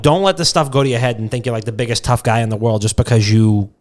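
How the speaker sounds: usual speaking voice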